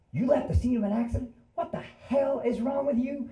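high-pitched, indignant wife impression; scolding wife. Storyteller as wife berating husband over accident, indignant. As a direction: play an outraged wife telling him off. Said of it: "his wife is there".

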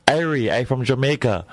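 put-on thick Jamaican accent, upbeat. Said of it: "the best Jamaican accent".

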